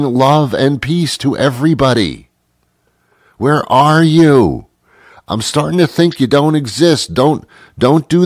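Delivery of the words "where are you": calling out. Acted. Calling out to the awaited sage.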